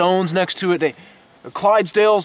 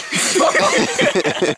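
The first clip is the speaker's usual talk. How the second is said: sudden laugh breaking the take; giggling breakdown; high burst of laughter. Real, not acted. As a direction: crack up high.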